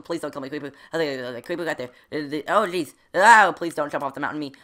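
normal talking voice

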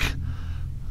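a short unvoiced burst of laughter; real airy laugh at his own joke; breathy laugh. Real, not acted. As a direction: laugh at yourself through the breath.

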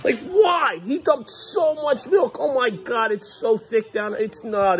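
high, slow, disgusted exasperation; groaning at the mess in disbelief; exasperated outburst. Real, not acted. Dad groaning over spilled milk on the couch, exasperated.

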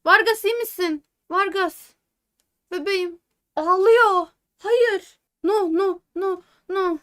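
high, loud, frantic cries for the hurt player; real alarm, calling out that she is hurt; loud panicked pleading; panicked cry. Real, not acted. Star player seems hurt, panic.

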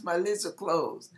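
normal talking voice

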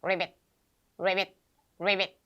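low, short croaked ribbits; frog sound imitation. Shown as a demonstration. Teacher demonstrating how English speakers imitate frogs, frog croak. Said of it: "이렇게 냈대요".

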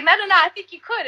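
high, insistent mock voice; playful impression of a friend; imitating Taddy. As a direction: coax her into it.

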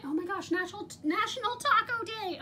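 sudden high squeal of delight; excited surprise. Real, not acted. Spots date in planner.

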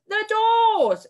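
a witch's thunderous roar of command; booming witch shout. Acted. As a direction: fill the whole hall with it. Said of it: "shouted the Grand High Witch in a voice that filled the room".

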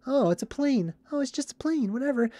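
high, soft, oblivious little voice; mock nonchalance. Mimicking people who never question the sky, airy dismissal.

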